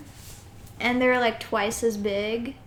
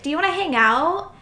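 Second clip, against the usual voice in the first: higher, louder quoted invite; imitated girl's invitation; 6 semitones higher, 5 dB louder than usual. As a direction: imitate a girl eagerly asking. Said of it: "this girl was like".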